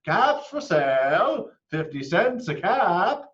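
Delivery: high, loud peddler's call; loud, high-pitched hawker's cry; calling out wares. A narrator's reading. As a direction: sing it out to the street.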